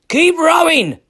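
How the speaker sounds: high, loud command; rallying shout. A narrator's reading. Eric urges his rowers through the rocks, loud high command. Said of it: "cried out Eric".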